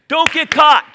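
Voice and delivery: loud high warning, cut short; preacher voicing Jesus' cry; urgent shout. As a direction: shout the warning across the room. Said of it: "Jesus says".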